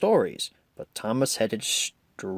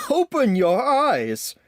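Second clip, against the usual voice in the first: laughing; 12 semitones higher, 4 dB louder, slower than usual. A narrator's reading.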